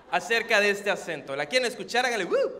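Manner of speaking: ordinary talk, nothing marked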